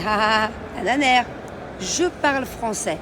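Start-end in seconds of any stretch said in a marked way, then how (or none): 1.8-3.0 slow teaching voice. Shown as a demonstration. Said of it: "models the phrase again".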